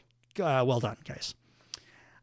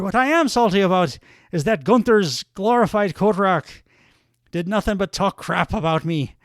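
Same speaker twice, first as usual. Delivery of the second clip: put-on Irish accent; 3 semitones higher than usual. Mocking his rival to the crowd in an Irish accent. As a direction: rant in a brash Irish brogue. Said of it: "some more accent work from me".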